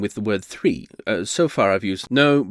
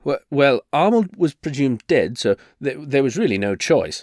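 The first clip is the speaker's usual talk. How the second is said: ordinary delivery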